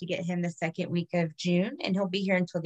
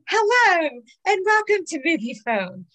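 announcer voice; 7 semitones higher, 4 dB louder than usual. Acted. Radio announcer parody intro.

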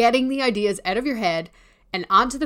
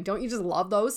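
ordinary delivery

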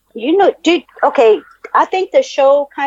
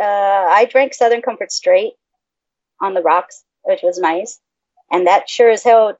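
ordinary delivery

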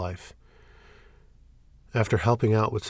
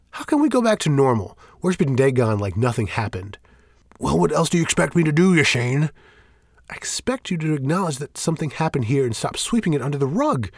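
loud, higher, heated pleading; heated argument; 6 semitones higher, 6 dB louder than usual. Acted. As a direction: push hard against his denial.

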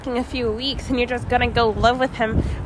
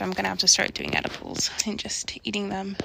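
whisper; 6 semitones lower, 8 dB quieter than usual. Real, not acted. Whispering outside.

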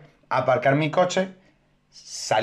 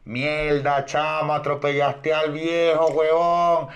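mock workers yelling; much slower than usual. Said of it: "Y empiezan".

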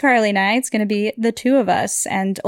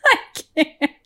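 laughing; 7 semitones higher than usual. Real, not acted. Cracking up mid-game.

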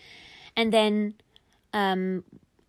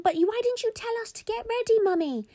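high, quick plaintive question; upset kids. Acted. Kids blame Mummy for the missed play date, upset. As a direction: whine it like a let-down child. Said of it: "the kids were quite upset".

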